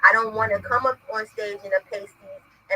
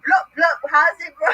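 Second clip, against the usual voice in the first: fake Jamaican accent; 6 semitones higher than usual. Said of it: "fake Jamaican".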